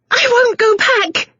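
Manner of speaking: high, slow hysterical shriek. A narrator's reading. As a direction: shriek it in panic, fighting free. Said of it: "she shrieked, panting furiously".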